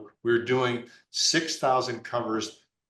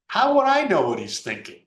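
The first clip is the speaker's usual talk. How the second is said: mock exasperated; 9 semitones higher, 6 dB louder than usual. Retold exasperated reply.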